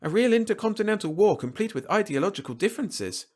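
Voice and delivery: very high, swooping boast; proud. A narrator's reading. Malan boasting of his war to Earthmen, high and proud. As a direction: show it off with great pride. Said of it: "the man stated proudly".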